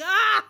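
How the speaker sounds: high, loud laughing yelp; comic shriek of horror, breaking into laughter; mock scream. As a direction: scream in pretend shock. Mock scream at agent's killing joke.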